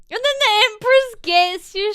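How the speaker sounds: Empress voice. Pretend. Voicing the Empress attacking.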